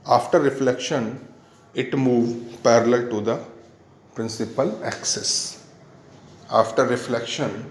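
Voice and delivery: very slow, broken-up dictation for note-taking. Real, not acted. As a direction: dictate phrase by phrase, with long gaps. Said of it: "मैं धीरे धीरे बोलता हूँ".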